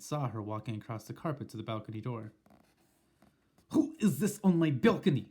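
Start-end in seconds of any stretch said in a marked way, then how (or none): 3.7-5.3 sharp witch voice, louder; muttered. Acted. Witch suspicious of someone on her balcony, sharp. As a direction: growl it suspiciously. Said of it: "she muttered".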